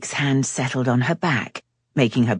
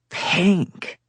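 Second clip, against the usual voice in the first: with disgust; 8 semitones higher than usual. A narrator's reading. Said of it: "he said with disgust".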